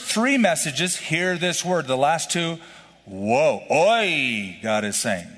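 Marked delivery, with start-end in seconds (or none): none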